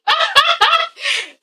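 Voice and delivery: high-pitched burst of laughter; laughing. Real, not acted. Laughs at own English pronunciation.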